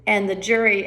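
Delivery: ordinary talk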